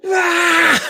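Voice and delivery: high yell of frustration; half-mock anguished shout; retold frustrated yell. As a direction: let it rip like a gutted fan. Yelling outside after bad news.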